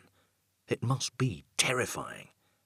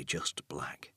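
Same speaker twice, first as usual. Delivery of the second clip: whispered; 5 semitones lower, 12 dB quieter than usual. A narrator's reading. Narration dropping to a whisper.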